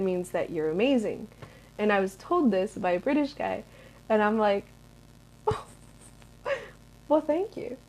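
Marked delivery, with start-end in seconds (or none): 5.5-7.8 high, soft flattered thanks; touched, airy little reply. Retold or imitated. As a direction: play up a coy, high thank-you. Acted flattered reply to compliment.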